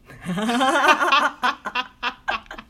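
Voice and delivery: long loud laugh at herself; big laugh. Real, not acted. Guest laughing at her own scary-to-kids joke, a loud laugh.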